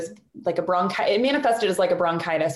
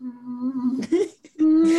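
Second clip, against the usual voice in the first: humming to test voice; 5 semitones higher than usual. Retold or imitated. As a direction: hum to check if the voice is there. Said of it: "trying to do some humming and get voice out".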